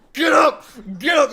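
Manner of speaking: loud, high alarmed yell; urgent shout. Retold or imitated. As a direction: bellow them awake in a panic. Dad wakes family, bear alarm.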